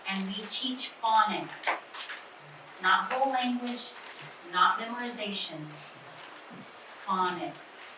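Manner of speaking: teacher driving home "phonics" over the rest; stress on phonics. Shown as a demonstration. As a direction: land hard on phonics, slow. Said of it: "stress on phonics".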